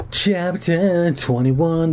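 slow, grand chapter heading; announcer voice. Acted. Podcaster announces the new chapter, drawn out and grand. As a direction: stretch it like a show host.

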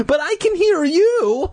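high, distressed demand; desperate demand. A narrator's reading. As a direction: demand it in panic. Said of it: "I declared".

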